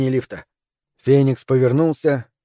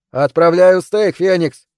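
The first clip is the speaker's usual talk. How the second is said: loud, slow, higher shout; 5 semitones higher, 6 dB louder, slower than usual. A narrator's reading. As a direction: call it out hard. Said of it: "крикнул я".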